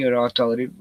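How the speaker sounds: ordinary talk, nothing marked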